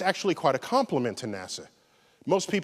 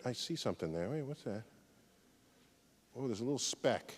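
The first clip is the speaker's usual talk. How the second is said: much softer, hushed pretend curiosity; hushed discovery; 4 semitones lower, 13 dB quieter, faster than usual. Showing the audience Saturn's image, hushed mock discovery. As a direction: lean in as if spotting something secret.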